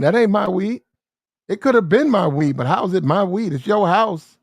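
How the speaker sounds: high-pitched, swooping protest of innocence; indignant denial. Acted. Man disowns the weed before police, indignantly incredulous. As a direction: argue it back, acting outraged. Acts out disputing weed's ownership.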